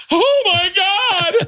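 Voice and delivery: very high, drawn-out horrified shriek; hammed-up high cry of horror; mock panicked scream. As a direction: shriek it slowly in comic terror. Daughter suddenly realizes the bees.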